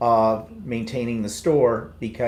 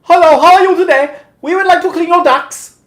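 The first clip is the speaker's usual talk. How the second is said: high, loud put-on Indian accent as a phone caller; sing-song Indian accent of a cold caller; mock Indian accent; 13 dB louder than usual. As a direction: imitate the Indian telemarketer cheerily, pitched way up. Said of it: "with an Indian accent going".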